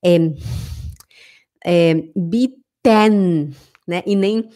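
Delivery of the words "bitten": "bitten" slowly with a full, drawn-out "tenn"; full -ten ending. Shown as a demonstration. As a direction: overpronounce the e in the end of "bitten". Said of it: "eles não falam".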